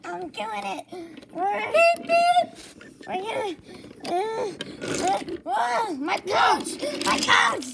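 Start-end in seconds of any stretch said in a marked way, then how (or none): 1.3-2.5 high loud beeping; horn beep. Acted. Engine horn imitated. 3.1-7.7 rising loud panic; panicked. Acted. Engine panics losing coach.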